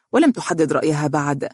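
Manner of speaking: ordinary delivery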